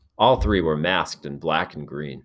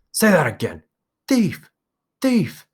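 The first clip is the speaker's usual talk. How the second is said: much higher, louder furious yelling of Thief!; angry shout; 12 semitones higher, 5 dB louder than usual. A narrator's reading. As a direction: hurl the insult back at him. Jack and Ralph quarrel, insults.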